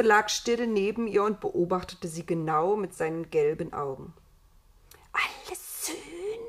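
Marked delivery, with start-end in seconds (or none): 5.1-6.4 high, soft, slow creature question; slithery whispered snake voice; hissing whisper. A narrator's reading. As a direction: hiss it as a sly question, rising at the end. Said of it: "zischte und wisperte ganz ohne Stimme".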